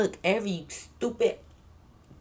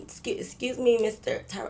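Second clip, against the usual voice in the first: soft, hesitant near-whisper; nervous whisper; 6 dB quieter than usual. Retold or imitated. Politely interrupting the armed host in a scared soft whisper. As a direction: ask timidly under your breath. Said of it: "but I'm whispering in y'all".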